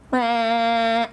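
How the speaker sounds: held "meh" with tongue out; tongue-out noise. Retold or imitated. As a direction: stick your tongue out and hold the sound. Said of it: "stick out my tongue now".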